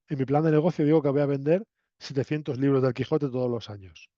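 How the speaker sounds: ordinary delivery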